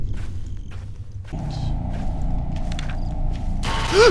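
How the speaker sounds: long sharp gasp of fright; terrified gasp. Real, not acted. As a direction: suck in air in terror. Slender Man appears suddenly.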